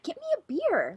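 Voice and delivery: quiet, high mock mouthing; mimed request. Star mimes a request to the women at the bar, mimed and quiet. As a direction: imitate a hushed request across the room. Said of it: "Mimes, like".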